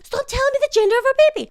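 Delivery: high, fast and loud husband; husband protesting in a high rush; mock frantic husband. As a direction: rattle it off in a squeaky panic.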